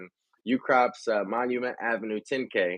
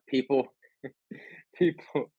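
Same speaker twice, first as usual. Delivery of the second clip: laughing; 4 semitones higher, 3 dB quieter than usual. Real, not acted. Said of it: "I'm laughing 'cause".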